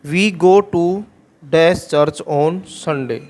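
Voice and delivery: very slow, word by word example sentence; teacher dictating slowly; slow dictation. Shown as a demonstration. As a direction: read it out slowly for students to copy. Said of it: "next sentence है".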